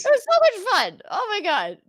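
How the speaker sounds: high, loud, delighted gushing; real excitement. Real, not acted. Host agreeing it was fun, excited squeal. Gushing over theater kid memories.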